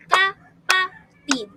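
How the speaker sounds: "kapatid" in three loud, separate beats; syllable by syllable. Shown as a demonstration. Teacher claps out a Filipino word, beat by beat. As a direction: break "kapatid" into ka-pa-tid, slowly. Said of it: "tatlong pantig".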